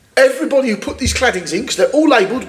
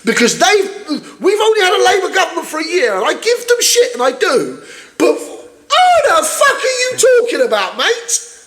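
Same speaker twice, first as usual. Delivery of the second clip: much higher, shouting in disbelief; rising angry rant; 9 semitones higher than usual. Real, not acted. Telling Tory committee members off, high outraged shout.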